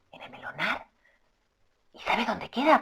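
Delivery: hushed question. Acted. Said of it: "dialogue reply".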